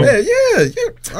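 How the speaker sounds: squeaky hyped yeah; excited high yeah. Real, not acted. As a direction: pitch leaps up on each yeah. Excited agreement, pitch jumps high.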